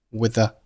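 with the as "wi-the", both weak; with the merged on a single th; silent th. Shown as a demonstration. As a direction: drop the th ending of with, keep the's. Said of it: "with结尾的th不发音".

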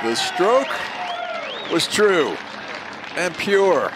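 high, loud, slow grand pronouncement; dramatic announcer call. Acted. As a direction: declaim it like a legend. Dramatic call after the putt.

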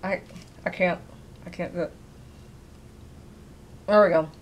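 speaking while biting down; teeth clenched on jerky. Real, not acted. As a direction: mouth gripping chewy meat. Seen 1: Teeth clamped on jerky, tugging, throughout the labelled words.

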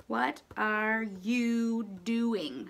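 stretched slow words for spelling; slow sounding-out; very slow dictation. Shown as a demonstration. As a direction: draw each word out as you write.

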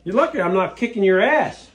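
ordinary delivery

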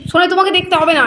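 scolding. Real, not acted. Scolding a child near cement.

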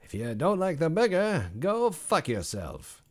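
high, slow, drawn-out mock delivery; redone in a silly voice. Chef insulting picky customers, slow hammed-up voice. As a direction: stretch out go fuck yourself, hammed up. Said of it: "Why did I say that like Regis Philbin".

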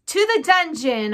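high, loud heckle from a crowd; crying out. A narrator's reading. Angry mouse condemning Despereaux, crying out. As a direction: cry out a demand for punishment. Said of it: "a voice cried out".

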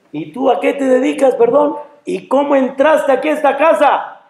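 high-pitched, alarmed questioning; indignant challenge. Acted. Man asking the angel how he got in, indignant. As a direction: demand answers from an uninvited visitor.

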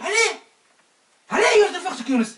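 loud, high call. Acted. Prankster calling the others, loud call. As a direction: call the guys over.

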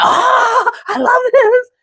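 high, loud delighted ah; delighted gush. Retold or imitated. Recalling joy at the man reading, high delighted gush. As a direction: gush with delight over the video. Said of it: "I was like".

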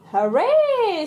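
high, loud cheer; cheering hooray. Acted. Pepper cheers her mummy's win, overjoyed. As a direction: celebrate. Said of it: "says Pepper".

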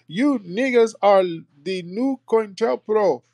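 high, slow, whiny mock accusation; hammed-up parody of a critic; mocking tethers. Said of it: "You always hear tethers hopping in our rooms".

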